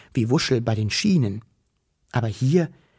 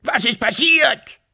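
high, drawn-out calls; frantic dragon shouting into the forest; loud alarmed calling; 12 semitones higher, slower than usual. A narrator's reading. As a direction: call out slowly for a lost friend. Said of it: "so laut es nur ging".

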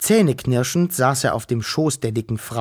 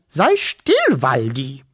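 scolding; 3 dB louder, slower than usual. Acted. Fat woman scolds her barking dog Waldi, sharp and loud. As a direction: snap at the dog to hush. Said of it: "sagte ärgerlich".

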